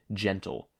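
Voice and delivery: ordinary delivery, nothing marked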